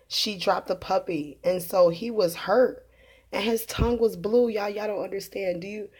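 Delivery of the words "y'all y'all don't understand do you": ordinary delivery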